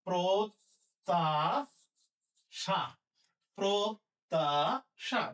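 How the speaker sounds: প্রত্যাশা very slowly as prot-ta-sha; syllable-by-syllable pronunciation. Shown as a demonstration. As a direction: stretch and separate each syllable of প্রত্যাশা, doubled t. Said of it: "তাহলে হবে কি".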